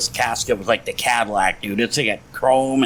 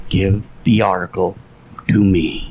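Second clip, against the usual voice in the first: lower, deliberate, halting demand; Sam Kinison; 6 semitones lower than usual. Acted. Comedian demands his buddy's disturbing article, low measured demand. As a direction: drop the pitch and space out the command. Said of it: "And then Sam goes".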